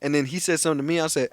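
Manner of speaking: ordinary talk, nothing marked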